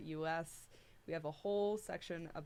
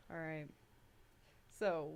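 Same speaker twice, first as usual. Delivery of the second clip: ordinary talk, nothing marked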